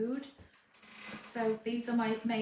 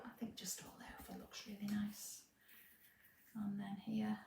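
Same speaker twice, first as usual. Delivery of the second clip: whispering; 11 dB quieter than usual. Real, not acted. Quiet decorating aside.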